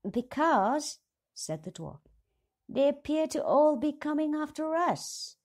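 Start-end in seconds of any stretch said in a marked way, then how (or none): 0.1-1.0 high, loud urgent voice; alarmed dwarf. A narrator's reading. 2.7-5.4 high, loud urgent dwarf voice; alarmed dwarf. A narrator's reading. Dwarf warns of pursuers.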